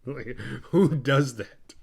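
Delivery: slow, drawn-out incredulous question with a laugh; laughing, can't-believe-it reaction; amused disbelief. Retold or imitated. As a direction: stretch each word in baffled amusement. Baffled by weird scene.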